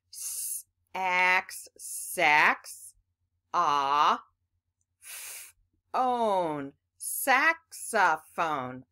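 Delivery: slow piece-by-piece sounding out. Shown as a demonstration. Teacher sounding out the word for kids. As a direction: break "saxophone" into parts, slowly. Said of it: "Let's see if we can figure it out".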